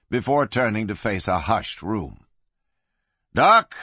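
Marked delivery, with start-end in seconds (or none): 3.3-3.7 high, loud call. A narrator's reading. Marshal to the doctor in the corner, calling out. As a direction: call across the room. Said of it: "he called to fat old Doc".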